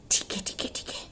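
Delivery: hushed whisper, barely voiced; whispered agreement. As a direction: kids whisper their agreement back to mother. Kids agree to mother. (फुसफुसाकर हामी भरते बच्चे)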